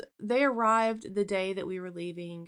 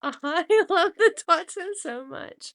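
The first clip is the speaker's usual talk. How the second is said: high, loud gush through tearful laughter; tearful delight; 7 semitones higher, 10 dB louder than usual. Real, not acted. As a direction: gush as happy tears take over. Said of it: "I'm, like, seriously crying".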